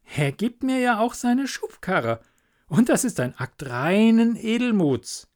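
a hint of a trusting simple man; little Hans, naive. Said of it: "pflegte er zu sagen".

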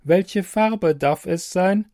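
a helpful machine, evenly spoken; computer voice. Acted. Computer voice in story.

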